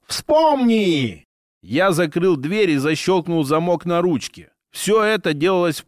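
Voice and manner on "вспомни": high piercing demanding creature voice; eldritch horror. A narrator's reading. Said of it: "Высокий пронзительный голос требовал".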